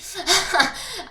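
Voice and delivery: short bright laugh at own joke; amused high-pitched giggle. Real, not acted. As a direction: laugh it off lightly. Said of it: "high on frequency".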